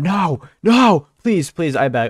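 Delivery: loud, high mock pleading; mock begging. Streamer mock-begging a viewer not to unsubscribe. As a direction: beg theatrically.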